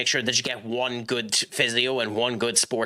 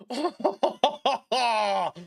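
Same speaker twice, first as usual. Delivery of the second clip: laughing; 10 semitones higher than usual. Real, not acted. Reacting to missed chance.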